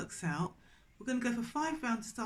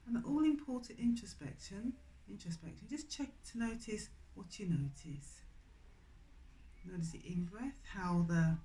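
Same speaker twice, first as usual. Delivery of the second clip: softer meditation voice inviting attention inward; guided meditation; 4 dB quieter than usual. Acted. Teacher guiding students to notice their breath, soft and calm. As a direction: draw them gently into noticing their breath. Guides the introspection after practice.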